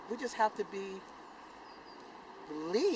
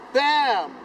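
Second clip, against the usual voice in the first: ordinary talk, nothing marked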